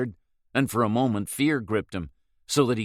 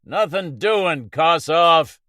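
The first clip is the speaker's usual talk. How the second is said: raised voice, loud and high; called out loud; 8 semitones higher, 8 dB louder, much slower than usual. A narrator's reading. Hagen calling out to Kossoff, loud. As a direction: shout it across the water. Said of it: "He raised his voice and called".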